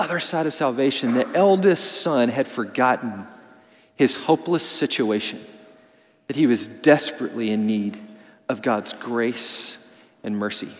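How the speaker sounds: hushed appeal. Real, not acted. Closing appeal, voice dropping.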